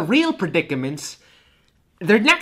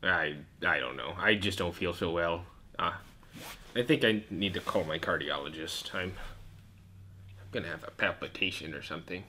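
put-on very low, soft old radio host; deep low voice; 10 dB quieter than usual. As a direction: murmur it like a tired old host. Hosts talk low and quiet.

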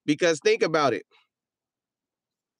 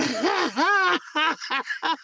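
high laugh; 12 semitones higher than usual. Real, not acted. Laughing at chat joke.

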